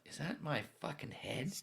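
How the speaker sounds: high, soft, bewildered self-talk; lightly voiced half-asleep confusion; groggy inner voice. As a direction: wonder aloud if it's your own head pounding. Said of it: "I'm like".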